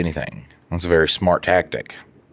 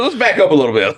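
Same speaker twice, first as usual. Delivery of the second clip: high put-on voice easing things down; hammed-up placating voice; mock calming; 10 semitones higher, 3 dB louder than usual. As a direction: pretend to defuse the fight. Mocking the arguing couple.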